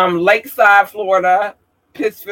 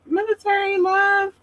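high-pitched cheer; 14 semitones higher, 5 dB quieter than usual. Real, not acted. Speaker salutes military followers, high-pitched affectionate cheer. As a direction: send love in a high, held squeal.

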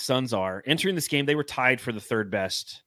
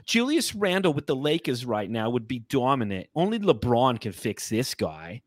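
put-on New York accent, higher voice; 5 semitones higher than usual. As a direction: rant like a New Yorker. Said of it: "so I'll go back to my".

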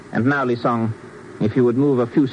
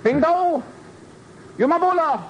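high-pitched military commands; shouted orders; 13 semitones higher than usual. Acted. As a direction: call out sharply to the troops. Officer orders men to disarm.

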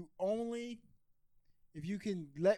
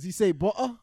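loud mock-British butter; exaggerated British glottal "butter"; UK accent; 4 semitones higher, 10 dB louder than usual. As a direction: drop the T's in butter like a Brit. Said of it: "one freaking U K accent type word".

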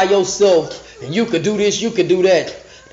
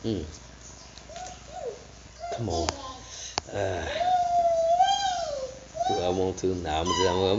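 muttered aside; 12 dB quieter than usual. Real, not acted. Preacher debating whether to read on, soft mutter. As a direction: mutter while deciding. Hesitating aside before skipping ahead.

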